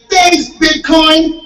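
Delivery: high, slow, giddy thank-you; excited thanks. Retold or imitated. Comedian thanking the Bitcoin mascot after a photo, giddy and high-pitched. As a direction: gush it like a starstruck kid at Disneyland.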